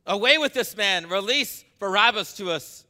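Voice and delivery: loud, high demanding shout; crowd shouting. A narrator's reading. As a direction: yell it as the angry crowd. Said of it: "the whole crowd shouted".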